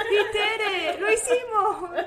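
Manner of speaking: high, laughing cheer; excited laughing. Real, not acted. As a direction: laugh through the celebration. Celebrating the engagement.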